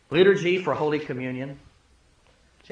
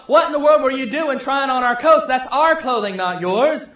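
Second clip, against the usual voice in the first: angry demand; 6 semitones higher, 5 dB louder than usual. Retold or imitated. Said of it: "gets angry and wants to know".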